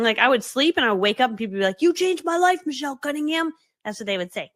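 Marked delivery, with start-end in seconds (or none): 1.8-3.5 high-pitched gushing praise; gushing fan. Retold or imitated. Fan praising her YouTube videos, gushing. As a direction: imitate an adoring fan, pitched up. Acted quote of adoring fans.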